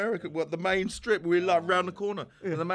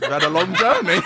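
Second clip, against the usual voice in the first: laughing; 3 semitones higher, 10 dB louder than usual. Real, not acted. Manager's quote dissolves into laughter.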